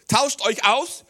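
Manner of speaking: high, loud command to share; emphatic command. Acted. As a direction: proclaim the call loudly. Jesus quote, loud.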